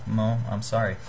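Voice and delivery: normal talking voice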